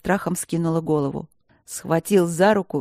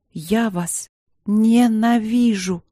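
slow, louder desperate cry; 4 semitones higher, 4 dB louder, much slower than usual. A narrator's reading. Princess tells the professor she hates him, slow and desperate. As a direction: spit it out through tears. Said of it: "сказала она отчаянно".

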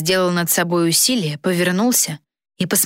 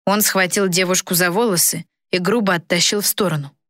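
ordinary delivery, nothing marked

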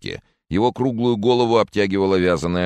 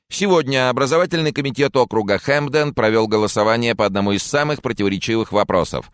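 higher-pitched studio presenter; TV news anchor; 6 semitones higher, 3 dB louder than usual. Acted. Anchor appeals to viewers for information, high announcing voice. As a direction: read the appeal as a brisk bulletin.

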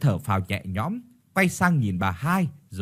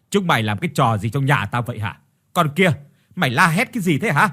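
scolding mother-in-law; 4 dB louder, faster than usual. Acted. Mother-in-law demanding what the screaming is about, scolding. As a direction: scold loudly, pushing up on the questions.

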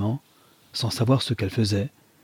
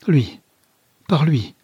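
a sudden louder, higher exclamation of hope; exclaimed; 5 semitones higher, 5 dB louder, slower than usual. Acted. Mother seeing her son in the garden, exclaiming louder. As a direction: cry it out as the answer strikes her. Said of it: "s'écria-t-elle".

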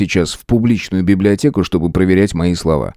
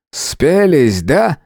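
higher-pitched, needling question; snide; 6 semitones higher, much slower than usual. A narrator's reading. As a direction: needle them with a sly accusation. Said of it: "ехидно сказал Иджилов". (ехидно, с издёвкой)

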